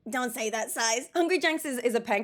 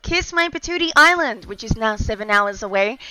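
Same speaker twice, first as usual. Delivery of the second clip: lightly hammed-up airline pilot over the intercom; louder mock captain's address; mock pilot announcement; 10 dB louder than usual. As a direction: play a pilot welcoming passengers aboard. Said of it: "I sound like a pilot".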